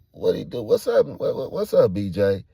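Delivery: high, loud, stumbling questions; urgent disbelief; alarmed questioning. Retold or imitated. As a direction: demand answers with the voice jumping up. Acting out his alarmed questioning.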